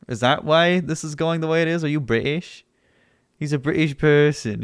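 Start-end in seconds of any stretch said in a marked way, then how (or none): none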